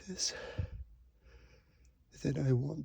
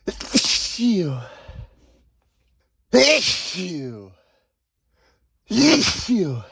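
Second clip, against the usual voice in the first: three loud sneezes in a row; 16 dB louder, much slower than usual. Acted. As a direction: sneeze repeatedly, hard. Sneezing fit.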